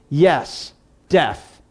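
low, halting frightened soldier; subdued, fearful admission; quivering voice. A narrator's reading. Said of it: "responded in a quivering voice".

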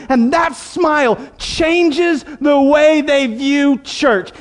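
high, driving pulpit delivery; insistent, rolling sermon exhortation; impassioned preaching. Real, not acted. Impassioned preaching at raised pitch.

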